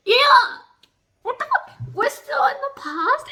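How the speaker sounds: loud, high outraged yelp; stunned outburst; shocked shout. Real, not acted. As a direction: cry out in disbelief at the page. Shocked reaction to the plot.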